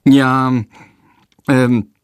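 hesitant stammer with pauses; nervous boy stalling the headmaster. A narrator's reading. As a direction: stall nervously, searching words. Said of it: "stottert Jendrik".

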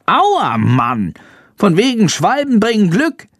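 high-pitched, annoyed bat voice; hissed grumbling. A narrator's reading. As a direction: grumble crossly in a high squeak. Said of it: "zischt Schandor".

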